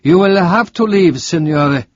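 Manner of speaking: firm nurse sending a visitor away; stern nurse in a hospital room; reproving nurse. Acted. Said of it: "She looked at Robert reprovingly".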